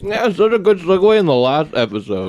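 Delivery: chewing while talking; words round a bite of food; mouth full. Real, not acted. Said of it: "I'm talking with my mouth full".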